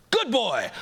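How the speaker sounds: high, startled yelp; surprised yelp. Acted. Rabbit-dog finds baby rabbits and yelps high. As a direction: blurt it out high and sudden. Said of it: "He yelps in surprise".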